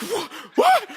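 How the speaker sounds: high, loud startled yelp; acting out a stunned kid. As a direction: blurt it out in shocked disbelief. Stunned son facing screaming mother.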